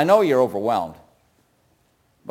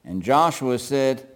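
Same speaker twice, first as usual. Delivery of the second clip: ordinary delivery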